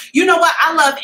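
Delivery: excited outburst. Real, not acted. Excited reaction to comment.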